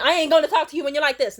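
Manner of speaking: loud, fast, high storming-off retort; loud fast mom walking out mid-argument; angry snap. Retold or imitated. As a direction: fling it at her and leave.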